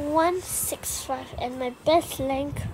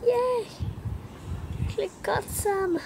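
much higher, thrilled squeal; delighted cheer; 8 semitones higher than usual. Real, not acted. Gamer finally scoring in the game, squealing with joy. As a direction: cheer like you finally won something. Finally eats some dots.

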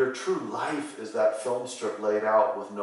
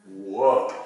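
mock amazement; 2 semitones lower, 5 dB louder than usual. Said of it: "kind of go".

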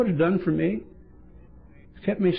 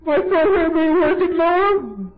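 very high, loud, crying whine; mock crybaby plea to the sponsor; whining; 14 semitones higher, 6 dB louder, slower than usual. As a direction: wail it like a helpless newcomer. Newcomer whining to sponsor at night.

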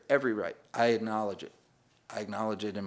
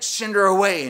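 mock demand; 8 semitones higher, 10 dB louder than usual. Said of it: "they're like".

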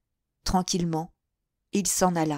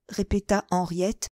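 ordinary delivery, nothing marked

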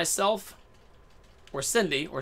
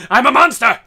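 much louder, higher mock battle cry; roaring boast; 7 semitones higher, 15 dB louder than usual. Gamer hyping himself up in battle, roaring boast. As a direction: bellow it like a rampaging monster. Hyped-up boast while playing the game.

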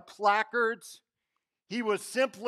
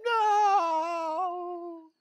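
high, loud, drawn-out no of dismay; anguished cry; 11 semitones higher, 4 dB louder than usual. Retold or imitated. Missing the [name] concert, a high wailing no. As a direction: cry out as if the worst news ever.

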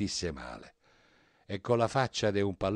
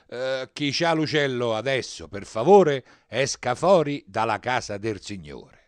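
raised, irritated voice; annoyed parish priest; 3 semitones higher, 9 dB louder than usual. A narrator's reading. Priest telling whoever has the bird to leave, annoyed. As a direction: order them out, loud and annoyed. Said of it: "il parroco seccato disse".

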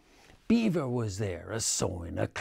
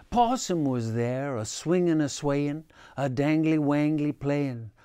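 folksy read-aloud; 3 semitones higher, 4 dB louder than usual. A narrator's reading. Reader telling children a swamp story, lively and bouncy. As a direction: tell it like a bouncy swamp tale for kids.

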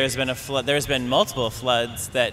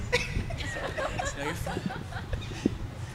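high breathy laugh; 13 dB quieter than usual. Real, not acted. Panel member laughs at remark.

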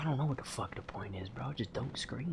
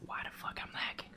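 hushed unvoiced whisper; 2 semitones higher, 15 dB quieter than usual. Real, not acted. Streamer whispering so the game won't hear. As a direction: keep it to a breath. Whispering to keep mic low.

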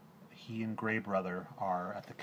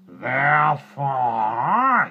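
much louder, slow, drawn-out roar of a word; Bagheera's roaring entrance; 7 semitones higher, 21 dB louder, much slower than usual. A narrator's reading. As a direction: burst in like a panther from the thicket.

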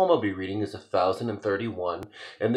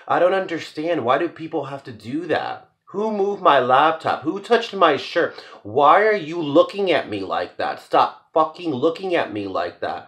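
louder, higher, exasperated questions; frustrated outburst; 7 semitones higher, 6 dB louder than usual. Acted. Poet voicing anxious speaker losing control, louder and exasperated. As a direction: let the anger spill over.

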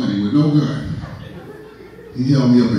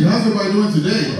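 put-on higher, energized African-accented greeting; more energy; 6 semitones higher than usual. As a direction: rouse the room in an African accent. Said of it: "I need more energy".

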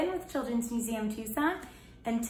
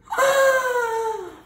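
high sharp gasp; horrified gasp; 12 semitones higher, 7 dB louder than usual. Acted. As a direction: gasp in fright.

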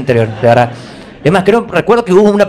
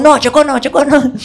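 higher, jeering teammate voice; mock teammates refusing; 7 semitones higher than usual. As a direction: mimic the kids saying no. Teammates refusing him, mocking.

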